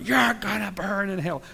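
high mock hellfire yell; mock brimstone preacher shouting damnation; fire and brimstone preacher. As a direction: play the street preacher condemning the crowd. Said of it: "he's screaming at everybody".

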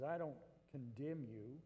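soft, slow "I don't condemn you", rising on I; tender quote. Acted. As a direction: speak it gently as Jesus to the woman. Jesus quoted gently.

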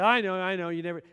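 angry coworker. Retold or imitated. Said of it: "He's just mad".

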